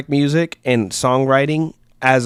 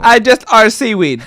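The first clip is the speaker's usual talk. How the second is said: louder, higher mock reading; mock misreading voice; 7 semitones higher, 8 dB louder than usual. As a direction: read it out wrong, loud and high. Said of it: "That's how I read that".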